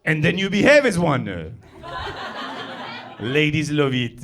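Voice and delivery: put-on loud comic French accent. Comedian promising that ladies love it, loud French accent. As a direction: keep up the hammed-up French accent. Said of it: "it's very easy to have a French accent".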